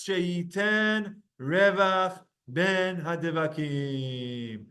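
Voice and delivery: each word drawn out; slow, deliberate recitation. Shown as a demonstration. Teacher slowly reciting the key phrase to the class. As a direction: say it slowly so every word stands apart. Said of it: "Very important words".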